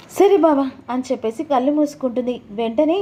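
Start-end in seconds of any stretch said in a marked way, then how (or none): 0.1-0.6 put-on slow then higher teasing young wife; coy and teasing about the photos; wife teasing husband about wedding photos, higher voice; voiced wife.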